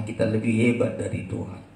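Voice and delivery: ordinary talk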